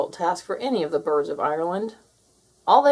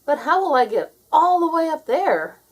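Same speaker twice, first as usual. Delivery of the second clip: Jake's higher, louder voice, anxious; worried Jake; 8 semitones higher, 7 dB louder than usual. A narrator's reading. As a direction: ask it like a scared little bird. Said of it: "he asked".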